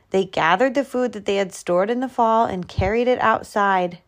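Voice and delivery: ordinary delivery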